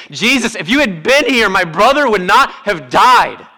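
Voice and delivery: Mary cuts Jesus off over her brother, anguished; anguished plea. Acted. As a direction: let the sister's hurt break through. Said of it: "says the exact same thing".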